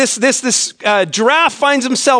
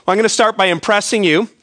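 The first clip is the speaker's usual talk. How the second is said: ordinary delivery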